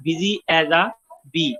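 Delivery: very slow, each word laid out; slow example reading. Shown as a demonstration. Teacher reading an alliteration example to students, slowly. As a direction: read it slowly so the b sounds stand out. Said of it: "बा sound की जो है वो repetition".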